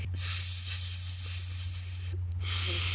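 soft hiss sliding from S toward TH; hiss, then move the tongue between the teeth. Shown as a demonstration. Teacher showing S turning into TH, soft hiss. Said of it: "Faz comigo".

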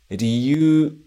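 "Ryu" said ri-yu-u, sounds separate; teacher models "Ryu" with syllables apart; uncontracted riyuu. Shown as a demonstration. Teacher showing reason word: "Ryu" as ri-yu-u. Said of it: "sem a contração".

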